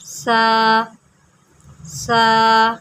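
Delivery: سا said softly, no hiss; سا with a soft lisped th, never an s; soft tha sound. Shown as a demonstration. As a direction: say سا gently without a whistle. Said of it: "اس کو پڑھیں گے نرمی سے".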